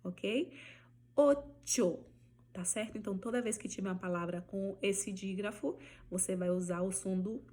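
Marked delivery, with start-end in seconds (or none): 1.1-2.0 Ocho with crisp CH, higher and louder; Spanish ch sound. Shown as a demonstration. Teacher demonstrating ch in ocho. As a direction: hit the CH in Ocho. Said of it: "o nome da letra já é o som dela".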